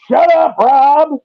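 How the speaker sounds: high, drawn-out mock yell; slow, high-pitched imitation of screaming; imitated scream. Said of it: "gif I made of you screaming".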